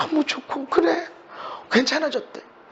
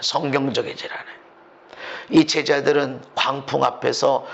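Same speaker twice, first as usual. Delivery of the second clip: usual speaking voice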